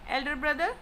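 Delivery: usual speaking voice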